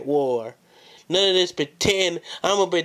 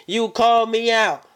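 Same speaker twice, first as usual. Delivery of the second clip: raised voice; 6 semitones higher, 4 dB louder than usual. Acted. Promo challenge.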